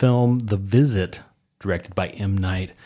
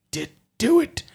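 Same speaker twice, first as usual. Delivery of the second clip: put-on gruff old fisherman; 7 semitones higher, 3 dB louder than usual. Old sailor character emerging.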